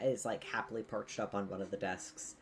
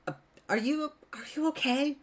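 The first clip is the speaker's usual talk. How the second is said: flustered, high and louder, halting and stammering; stunned greeting, pitched way up, groping for words; 11 semitones higher, 7 dB louder, slower than usual. Acted. Stunned character checking on someone just knocked away, flustered and high.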